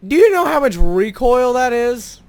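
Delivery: high, loud disbelief; incredulous outburst. Real, not acted. Gamer marvelling at the cannon's recoil, incredulous outburst. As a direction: raise the voice in amazed disbelief.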